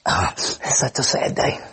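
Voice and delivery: slow, sniffly, half-voiced token sorrow; mock polite weeping. Preacher shows what weep doesn't mean, mock sniffly sadness. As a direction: sniffle faintly and act mildly sad. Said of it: "it doesn't mean somebody going like".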